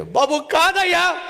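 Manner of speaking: high, loud, slowish crying no; tearful denial. Retold or imitated. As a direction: sob out the denial. Mourner denies through crying.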